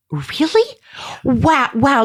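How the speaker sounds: imitating husband's delighted surprise; high, wondering wow; pleasantly surprised. Husband to wife who warmed the hot tub, surprised. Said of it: "And he was like".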